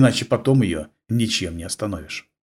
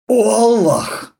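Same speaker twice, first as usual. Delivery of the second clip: slow, high anguished groan; groaning in helpless rage; 11 semitones higher, 3 dB louder, much slower than usual. Acted. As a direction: moan it to the sky, powerless. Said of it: "простонал он в бессильной ярости".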